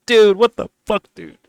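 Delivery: high, loud exasperated cry of disbelief; frustrated outburst. Retold or imitated. Ignored creators venting at being brushed off, loud frustrated shout. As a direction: blurt it out, fed up and high-pitched. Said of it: "They're like".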